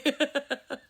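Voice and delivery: high laughing fit; real uncontrolled laughter. Real, not acted. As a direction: lose it laughing. Breaking up on retake.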